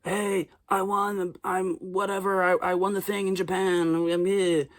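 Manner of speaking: hushed slow call home to family; Timothy calling home. Retold or imitated. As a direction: lightly play the star on the phone home. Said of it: "He's calling home".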